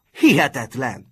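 loud burst of disbelief; outraged shout. A narrator's reading. Martin beside himself at the broken promise, shouting. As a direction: explode with indignation. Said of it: "kiáltotta magán kívül".

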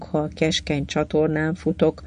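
usual speaking voice

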